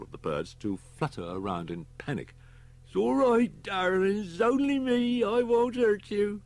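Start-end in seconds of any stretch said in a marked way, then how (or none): 2.9-6.4 put-on old man cooing to his pigeons; tender old man's voice. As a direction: soothe the pigeons in a high voice.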